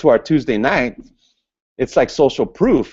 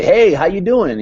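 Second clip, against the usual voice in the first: higher, louder mock greeting; eager hello; high energy; 9 semitones higher, 5 dB louder than usual. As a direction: voice the pumped-up prospect. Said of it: "meet that person's energy".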